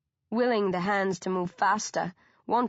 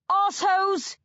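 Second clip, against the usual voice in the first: loud high roar; 11 semitones higher, 5 dB louder than usual. A narrator's reading. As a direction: roar it up at them in fury. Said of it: "I roar".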